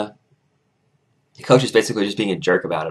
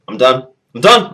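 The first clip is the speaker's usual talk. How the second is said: louder on each repeat; fed-up declaration; 4 semitones higher, 8 dB louder than usual. Retold or imitated. Student walking away from the coach, fed up. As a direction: throw it over your shoulder, higher and louder.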